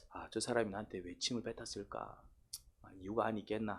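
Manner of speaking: low, soft self-questioning; meek musing. Acted. Spat-upon person wondering at the reason, meek quiet musing. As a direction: muse quietly, excusing the offender. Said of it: "하고 닦는다면은".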